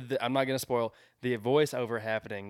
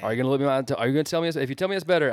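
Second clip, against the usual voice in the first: excited; 4 semitones higher, 6 dB louder, much faster than usual. Real, not acted. Heated mock challenge to friend.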